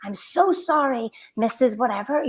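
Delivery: mock shout, then a fake-polite apology; imitating a scolding mother. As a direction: ham up the mother blaming her daughter. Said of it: "she shouted out".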